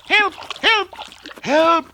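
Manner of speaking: high, loud chick squeals; cries for help. A narrator's reading. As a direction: yelp for help in short bursts. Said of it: "cried Chirp".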